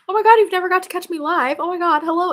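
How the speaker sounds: high, loud and thrilled; real excited squeal. Real, not acted. Viewer finally catches her live.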